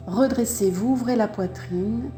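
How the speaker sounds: ordinary delivery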